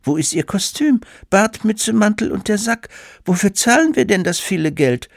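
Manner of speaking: high-pitched indignant mother; aghast mother. A narrator's reading. Mother demands the costume from hired Santa, aghast. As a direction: complain in a high, appalled mother's voice. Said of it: "fragte die Mutter entgeistert".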